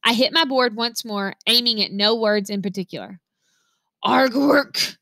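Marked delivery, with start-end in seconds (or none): 4.0-5.0 screeched. A narrator's reading. Frustrated girl screeching at her board, a strangled screech. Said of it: "I screeched".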